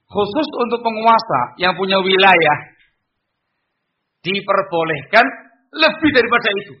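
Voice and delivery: high, loud preaching; forceful declaration. Shown as a demonstration. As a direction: proclaim it with stern authority. Preacher showing the stronger tone of authority.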